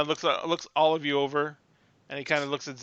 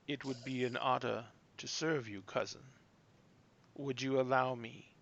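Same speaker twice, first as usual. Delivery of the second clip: calm half-orc; 9 dB quieter than usual. Acted. Half-orc humbly offers to serve a cousin, calm and soft. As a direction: speak quietly and unhurriedly, offering service. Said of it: "regards you in a very calm manner".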